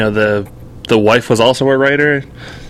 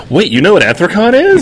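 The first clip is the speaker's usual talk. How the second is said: retold disbelief, jumping higher in pitch; astonished; 7 semitones higher than usual. Employee finding the boss knows the convention, astonished. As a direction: act out being taken aback, voice shooting up.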